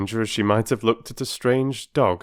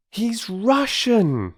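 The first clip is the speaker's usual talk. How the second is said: put-on much higher, slow excited boy's cry; 4 dB louder, much slower than usual. Peter crying out that the stranger is Russian, high excited voice. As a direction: cry out the discovery. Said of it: "cried Peter".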